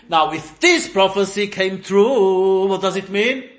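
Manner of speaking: loud, rising to a high triumph; acted exultant explanation. Rabbi Akiva tells the sages why he is laughing, exultant. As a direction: build to a jubilant, emphatic finish.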